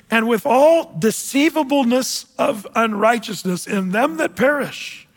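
slow, solemn Bible reading; measured pulpit reading voice; scripture reading. Acted. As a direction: read each phrase slowly, like a verse from the pulpit.

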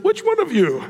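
high, pointed mock challenge; pointed question. Jesus teasing the Pharisees about their sheep, pointed. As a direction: call them out, playfully. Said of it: "And then Jesus said".

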